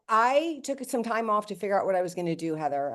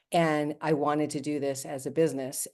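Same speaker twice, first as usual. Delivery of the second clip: ordinary talk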